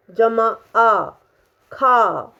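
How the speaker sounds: ordinary delivery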